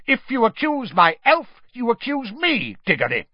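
high, loud shouted challenge; angry shout. A narrator's reading. Crouch shouts at Diggory to defend his elf. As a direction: throw the threat back at him. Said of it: "shouted Mister Crouch".

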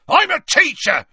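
furious, high, loud roar; Hagrid roaring. A narrator's reading. Hagrid facing Harry at his door, furious roar. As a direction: bellow it in outrage. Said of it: "he roared at Harry".

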